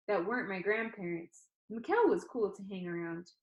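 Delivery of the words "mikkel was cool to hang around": plain reading voice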